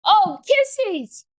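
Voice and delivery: high squeal as the dog kisses her; high delighted squeal. Real, not acted. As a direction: shriek with delight at the puppy. Dog licking her face.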